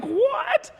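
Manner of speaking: very high, startled "what"; hammed-up astonishment; shocked. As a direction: squeal it in disbelief. Teller shocked by accusation.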